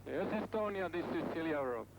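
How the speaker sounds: höher, antwortend über Funk; Seefunk. Real, not acted. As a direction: verrauschter Funkspruch. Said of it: "den Notruf der Estonia auf".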